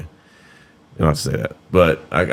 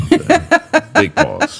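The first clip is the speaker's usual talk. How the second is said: mock big pause call; 3 dB louder, much slower than usual. Shouted joking "big pause.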